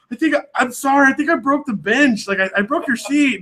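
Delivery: high, embarrassed apology; flustered apology. Retold or imitated. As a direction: blurt out sorry, mortified. Apologizing to boat owner, embarrassed.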